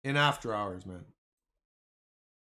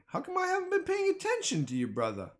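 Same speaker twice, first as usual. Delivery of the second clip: much higher, quick put-on voice; mock whiny voice; 13 semitones higher, 5 dB quieter, faster than usual. Mimicking a chatter who was yelling, mock whiny. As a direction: parody a viewer who's been yelling. Mocking a chat member's voice.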